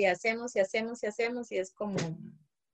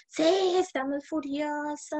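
higher, slow, playfully acted anger; mock furious agreement; 6 semitones higher, slower than usual. Teacher joining the child's anger, in mock fury. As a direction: play along with the angry child, lightly. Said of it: "que él está furioso".

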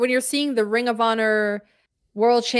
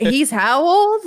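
higher, louder, slow disbelief; incredulous retold reaction; 5 semitones higher, 5 dB louder, slower than usual. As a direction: stretch out the question in mock astonishment. Reacting to a wrestler's age.

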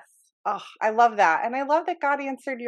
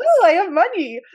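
mock delight; 8 semitones higher, 8 dB louder than usual. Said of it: "favorite little text message".

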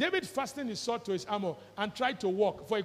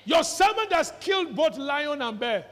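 shouted; 5 semitones higher, 7 dB louder than usual. Acted. Preacher voicing David.

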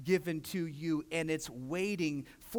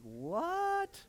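a high startled "What?"; retelling his shock, pitched way up; mock surprise; 12 semitones higher than usual. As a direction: act stunned at the news. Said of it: "I was like".

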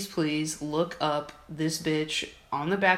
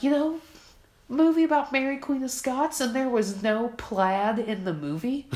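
mocking voice; 7 semitones higher than usual. Mocking coworker's complaint.

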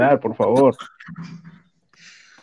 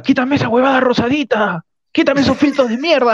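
high-pitched mock yell of orders; mock shouting; 7 semitones higher than usual. Pechi imitated yelling at the technician, mock shout. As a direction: bark it like an angry host at the crew.

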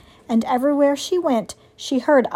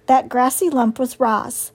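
usual speaking voice

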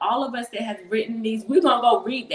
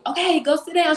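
raised, higher-pitched parental order; exasperated mother; 7 semitones higher, 4 dB louder than usual. Retold or imitated. Mom to her whining five-year-old, exasperated. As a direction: order a child off, mildly fed up.

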